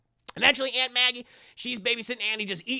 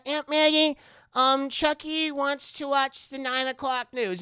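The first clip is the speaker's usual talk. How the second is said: put-on higher, slow kid voice; earnest child, pitched up; little boy voice; 6 semitones higher, 4 dB louder than usual. Andy tells Aunt Maggie what Chucky wants, higher and slow.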